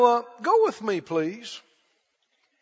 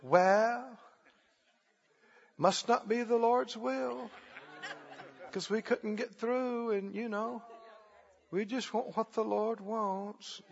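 mock meek excuse; 3 semitones lower, 16 dB quieter than usual. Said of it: "a lot of folk would say".

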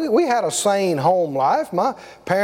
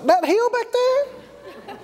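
much higher, slow puzzled question; incredulous slow question; surprised disbelief; 12 semitones higher, much slower than usual. Retold or imitated. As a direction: sound amazed it was a problem. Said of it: "He said".

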